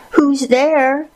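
put-on high, frail old woman's call; high-voiced, fragile grandmother. A narrator's reading. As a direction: answer the knock as a frail granny, pitched well up. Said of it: "asked Grandmother in a frail voice".

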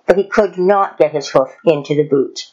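usual speaking voice

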